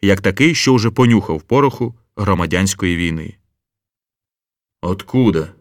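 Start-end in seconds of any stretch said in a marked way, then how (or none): 4.8-5.5 sluggish asking. A narrator's reading. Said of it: "мляво допитувався Кромешний".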